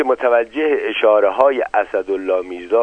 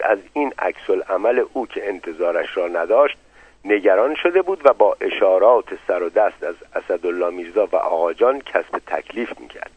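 ordinary delivery

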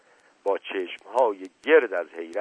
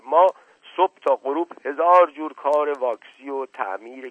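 higher, loud, anxious persuasion; worried urging; 5 semitones higher, 5 dB louder than usual. A narrator's reading. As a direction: plead with him not to leave. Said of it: "با نگرانی گفت".

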